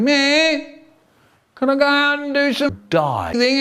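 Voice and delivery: high-pitched pleading whine; whiny kid. Retold or imitated. Pupil begging the strict teacher, high whiny voice. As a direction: whine it like a pestering schoolchild. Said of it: "there was always a whiny kid going".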